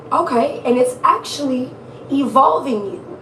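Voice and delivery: ordinary talk, nothing marked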